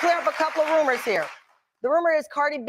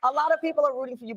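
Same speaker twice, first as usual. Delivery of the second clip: ordinary delivery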